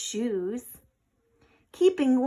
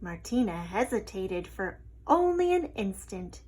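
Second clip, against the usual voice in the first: ordinary delivery, nothing marked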